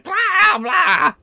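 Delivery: very high, loud creature blahs; startled creature squawk. Acted. Game master as the cut Skidamander, startled squawking. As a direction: squawk like a small startled critter.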